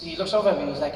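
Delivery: ordinary talk, nothing marked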